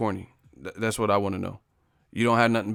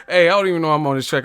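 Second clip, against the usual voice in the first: fast, louder, higher hype; riding the beat and getting loud; hyped outburst; 5 semitones higher, 5 dB louder, faster than usual. Real, not acted. Streamer hyping himself up over the beat, fired up.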